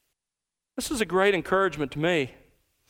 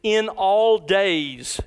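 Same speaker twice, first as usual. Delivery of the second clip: "all" drawn out very slowly; very slow, deliberate "in all days"; stress on all; much slower than usual. Shown as a demonstration.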